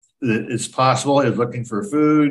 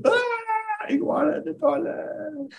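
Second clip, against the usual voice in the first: startled scream; 5 dB quieter than usual. Retold or imitated. Retelling woman's startled scream.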